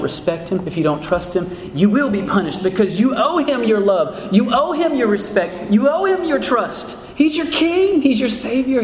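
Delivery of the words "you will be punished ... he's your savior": preaching climax, pitch climbing with each phrase; fervent pulpit exhortation; impassioned preaching. Real, not acted. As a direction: build insistently, rising to a high-pitched peak.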